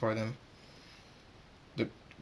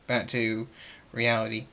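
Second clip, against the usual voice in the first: usual speaking voice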